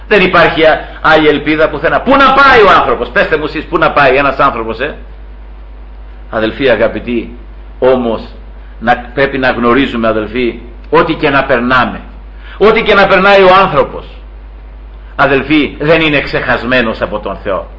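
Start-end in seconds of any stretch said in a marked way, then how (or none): none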